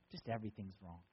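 low, soft, defeated mumble; a sulking Jesus moaning that nothing is right; gloomy sulk. Pretend. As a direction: drop the voice to a hopeless murmur. Imagined Jesus answers gloomily.

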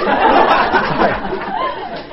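a burst of high, breathy laughter at a blunder; embarrassed giggle at a slip of the tongue; high laugh at own slip. Real, not acted. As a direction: crack up at your own mistake.

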